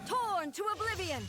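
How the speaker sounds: high, forceful attack shout; battle cry. Acted. Warrior shouting a battle cry mid-attack. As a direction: strike hard and call it out.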